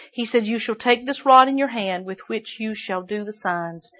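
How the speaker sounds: ordinary talk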